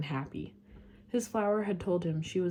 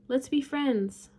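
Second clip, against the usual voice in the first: calling out; 7 semitones higher, 8 dB louder than usual. A narrator's reading. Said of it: "he said".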